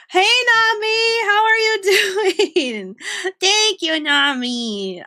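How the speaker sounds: high, slow, bright-pitched greeting with a laugh; excited greeting. Real, not acted. Greeting her friend Nami in a high, slow excited voice. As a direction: call out to a friend with delight.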